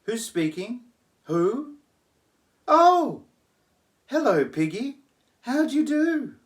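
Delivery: very high, loud genteel lady; posh young lady. Acted. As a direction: trill it like a society girl.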